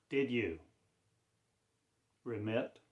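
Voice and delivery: usual speaking voice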